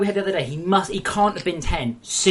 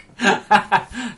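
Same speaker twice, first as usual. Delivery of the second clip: louder, higher burst of laughter; laughing; 5 semitones higher, 5 dB louder than usual. Real, not acted. Laughs at doing accents on request.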